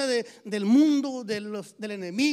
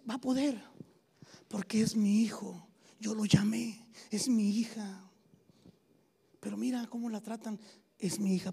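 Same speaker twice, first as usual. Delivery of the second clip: God's voice as a soft whisper, lower; tender whisper; 4 semitones lower, 3 dB quieter than usual. Retold or imitated. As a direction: whisper it as a loving father, confident in his child. God speaks tenderly, whispered. (Dios susurrando con ternura a su hijo)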